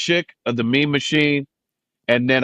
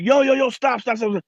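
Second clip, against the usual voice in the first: high, urgent cut-in; excited interruption; 11 semitones higher than usual. Retold or imitated. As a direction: imitate amazed listeners halting the rapper. Acts amazed listeners halting his rap.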